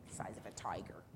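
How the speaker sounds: low whispered wonder; hushed aside. Real, not acted. Hushed aside to young listener.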